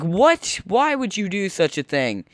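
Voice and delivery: high, wounded disbelief at the machine; mock indignation. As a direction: scold the machine in mock betrayal. Machine rejects the quarter.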